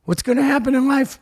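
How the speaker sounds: high-pitched mock panic; mock fretting. Comedian imitating his own anxious younger self, mock fretting. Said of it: "I was like".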